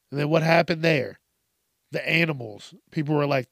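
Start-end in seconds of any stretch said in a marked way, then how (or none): none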